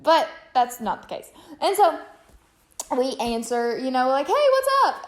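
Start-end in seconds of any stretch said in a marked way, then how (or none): 4.3-5.0 high, bright-pitched excited hello; louder, high-pitched eager phone greeting. Retold or imitated. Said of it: "we're all excited".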